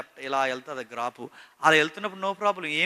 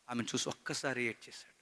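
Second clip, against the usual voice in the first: ordinary talk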